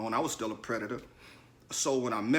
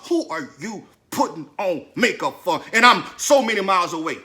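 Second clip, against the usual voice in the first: retold indignant rant, building louder; mock outrage rising to a loud finish; giving it to her; 4 semitones higher, 10 dB louder than usual. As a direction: reenact chewing her out, punching the words. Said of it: "I was finna give it to her".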